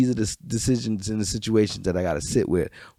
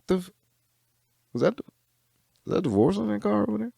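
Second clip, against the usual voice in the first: high mock surprise; 11 semitones higher, faster than usual. Retelling spotting divorce on train.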